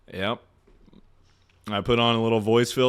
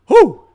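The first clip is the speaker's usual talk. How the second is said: a big high loud whoosh of a whew; 17 dB louder than usual. Real, not acted. Streamer blowing off steam after a rant, loud whew. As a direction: blow out a loud relieved whew. Exhales after ranting answer.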